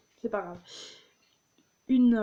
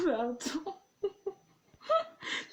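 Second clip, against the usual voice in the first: laughing; 8 semitones higher, 7 dB quieter, much slower than usual. Real, not acted. Cracking up after the joke.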